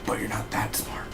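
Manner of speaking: whispered villain taunt; mock whispered threat. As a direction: hiss the insult in a whisper. Villain taunting in quoted dream.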